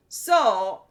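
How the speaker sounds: normal talking voice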